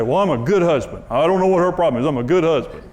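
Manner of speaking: a hint of whiny self-praise; mocking self-satisfied husband. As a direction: mimic a defensive husband with mild scorn. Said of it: "how pathetic is that".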